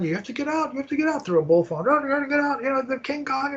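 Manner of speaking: high voice calling out a warning from the helicopter; imitating helicopter warning. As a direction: play the woman shouting down a warning through a bullhorn from above. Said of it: "she's yelling down ... through a bullphone".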